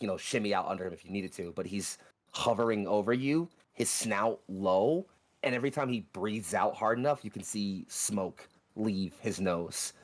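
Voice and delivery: normal talking voice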